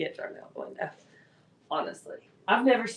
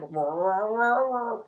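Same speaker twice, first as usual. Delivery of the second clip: puffed-up cat noise; slower than usual. Retold or imitated. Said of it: "he, like, puffed up, and he was like".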